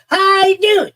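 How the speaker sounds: high, loud, twangy Southern greeting; mock a Southern lady saying hello; Southern accent. Grandfather imitating a Southern lady at the ballgame, Southern accent. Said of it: "That was a terrible Southern accent".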